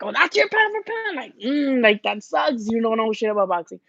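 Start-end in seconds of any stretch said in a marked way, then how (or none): 0.0-1.2 sneering mock voice pitched way up; high, taunting impression of a hater; mocking critic. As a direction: mimic a snide commenter, high and scornful. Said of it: "people always talk shit like".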